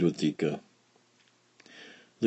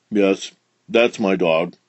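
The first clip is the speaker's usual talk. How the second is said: cross; 3 dB louder than usual. A narrator's reading. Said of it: "he said crossly".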